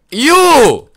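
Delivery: high, loud shriek; rage yell. Real, not acted. Gamer yelling at his character, high angry shriek. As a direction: scream it at the game. Raging at the game.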